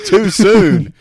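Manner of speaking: high, loud and laughing; laughing mock protest. Real, not acted. Friend reacting to a nine eleven joke, laughing protest. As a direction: protest the joke while cracking up.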